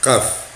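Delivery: "Kaf" avec un q arabe profond, lentement; "Kaf" dit avec le ق de gorge; prononciation arabe du Kaf. Shown as a demonstration. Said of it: "je sais dire Kaf". (lettre "Kaf" articulée en ق arabe)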